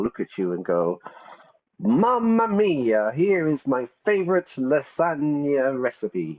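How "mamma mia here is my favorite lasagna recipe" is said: exaggerated Italian accent, sing it out; mock Italian accent, self-mocking; bad Italian accent. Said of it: "my really bad stereotypical accent".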